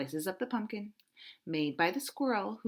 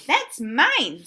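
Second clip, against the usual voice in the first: loud, high squirrel squeak; 5 semitones higher, 11 dB louder than usual. Acted. Squirrel squeaks at Duck to claim the spoon, loud. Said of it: "squeaked the squirrel".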